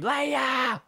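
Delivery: high, stretched mock scream; imitated scream. Host predicting her reaction to a winner off the net, mock scream. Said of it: "she's just gonna scream".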